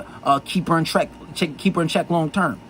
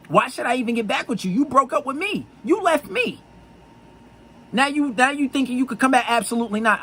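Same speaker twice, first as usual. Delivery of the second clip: mock indignant; 8 semitones higher than usual. Acted rebuff of returning ex.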